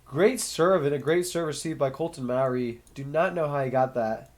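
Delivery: ordinary talk, nothing marked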